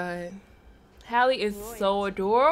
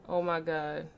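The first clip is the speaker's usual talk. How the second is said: ordinary delivery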